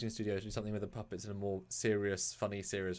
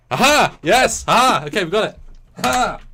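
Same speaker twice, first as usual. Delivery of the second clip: loud, higher hammed-up puppet yell; drunk puppet voice; 10 semitones higher, 13 dB louder than usual. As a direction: bellow it like a silly drunk puppet. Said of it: "now he's officially that voice".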